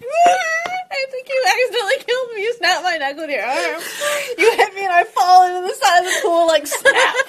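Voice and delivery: high-pitched, helpless laughter through the words; squealing with real amusement; laughing. Real, not acted. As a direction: narrate it while cracking up. Game master cracking up over death.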